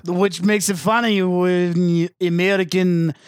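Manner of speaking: mock-Russian explainer; comic Russian-accented English. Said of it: "antebellum Russia".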